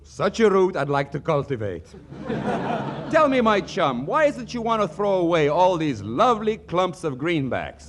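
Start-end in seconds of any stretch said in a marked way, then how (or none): 2.1-3.0 short laugh. Acted. [name] laughs at own quip.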